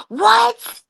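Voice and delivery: high, loud squeal of disbelief; shocked what. Retold or imitated. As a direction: yelp it out in shock. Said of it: "I'm like".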